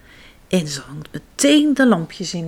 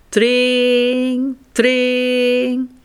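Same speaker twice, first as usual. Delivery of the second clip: doorbell ring; 3 semitones higher than usual. A narrator's reading. Doorbell imitation.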